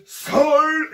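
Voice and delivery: loud, high imitation of a headmaster; hammed-up shout for order; booming command. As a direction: bellow it over a panicking crowd. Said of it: "the loudest he's ever been".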